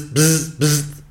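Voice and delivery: high buzzing mimic of an office buzzer; imitated buzzer. Mimicking the boss's buzzer summons, high buzz. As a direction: buzz it out like the intercom.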